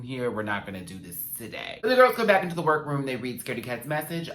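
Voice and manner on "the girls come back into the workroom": energetic announcer. Acted. Recap line, punched take.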